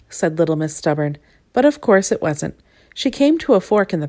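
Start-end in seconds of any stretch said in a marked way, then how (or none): none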